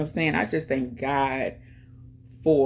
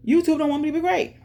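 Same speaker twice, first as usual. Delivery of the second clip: mock complaint; 12 semitones higher, 4 dB louder, faster than usual. Real, not acted. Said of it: "complaining about YouTube".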